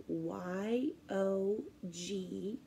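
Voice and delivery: letters spelled slowly, fading to a whisper; slow spelling. Shown as a demonstration. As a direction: spell it out gently for kids. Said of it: "our yogurt spelled too".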